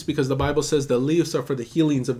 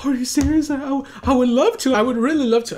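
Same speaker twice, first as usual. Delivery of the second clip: much higher imitation of an overjoyed lady; thrilled disbelief; 11 semitones higher, 4 dB louder than usual. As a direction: gush in a high voice, as the lady offered the baby. Retells lady thrilled at offer.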